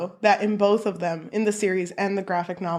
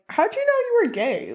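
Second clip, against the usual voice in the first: quick and much higher, eager question; curious Nick; 12 semitones higher, faster than usual. Retold or imitated. Nick asking Charlie in the conservatory, quick nervous voice. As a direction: blurt it out like a nervous boy.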